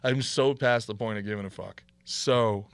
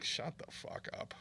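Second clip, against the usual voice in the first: hushed retort; 5 semitones higher, 11 dB quieter, slower than usual. Retold or imitated. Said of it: "and I'm like".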